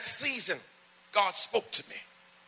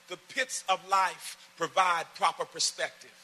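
slow, deliberate emphasis; 2 semitones higher than usual. Shown as a demonstration. Pastor slowing down so the church catches it, deliberate. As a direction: lay each word down slowly. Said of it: "I'm gonna say that slow".

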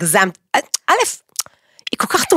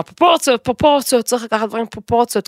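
mocking people; 4 semitones higher, faster than usual. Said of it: "אני שונאת שאומרים".

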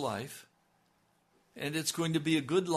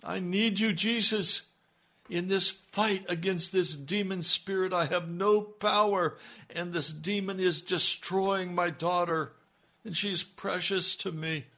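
high, loud pleading prayer; desperate confession; 4 semitones higher, 4 dB louder than usual. Shown as a demonstration. Preacher modelling the confession the congregation should cry.

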